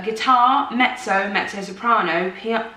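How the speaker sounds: normal talking voice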